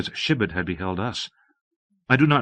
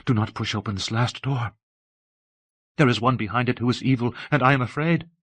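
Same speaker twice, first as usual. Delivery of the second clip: understated low, urgent warning; low, quick voice. A narrator's reading. Abbess warning of evil behind the door, low and quick. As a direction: drop back down and warn quickly. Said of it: "in a low, quick voice".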